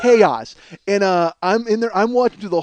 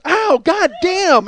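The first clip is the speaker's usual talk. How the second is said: imitated cry of pain; 7 semitones higher, much slower than usual. Stabbed woman's cry acted out.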